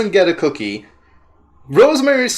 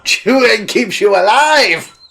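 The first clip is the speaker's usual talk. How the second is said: indignant exclaim; 5 semitones higher, slower than usual. Real, not acted. Retorts to a game line.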